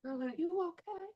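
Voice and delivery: high, loud, fast mock-worried friend; imitating shocked friends, high and loud; concerned friends. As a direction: gush with alarmed sympathy.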